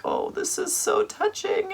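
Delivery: very high falsetto coo; cooing like a dove. Retold or imitated. Sister cooing to her brother at the funeral, very high. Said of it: "about to coo like a dove".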